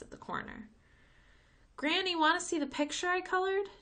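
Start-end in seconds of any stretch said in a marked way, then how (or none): 1.8-3.7 put-on high, loud excited child's call; little girl yelling from the porch. A narrator's reading. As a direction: call out across the porch to Granny. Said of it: "Tamika yelled".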